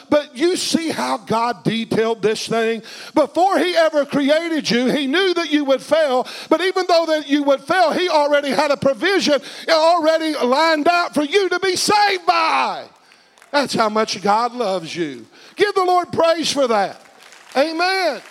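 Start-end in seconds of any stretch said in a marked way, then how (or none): 3.1-12.8 high, driving sermon delivery rising to a shout; preaching climax. Real, not acted. Preacher telling the congregation God planned their rescue, building to a shout. 15.6-18.2 high, ringing preacher's call; rousing praise call. Real, not acted. Preacher urging the congregation to praise, rousing.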